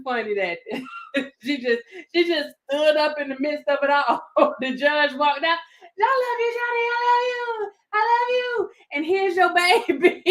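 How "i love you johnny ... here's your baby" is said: high, mock shouted declaration of love; mock yell. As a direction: ham up a woman yelling across the courtroom. Woman shouting in courtroom.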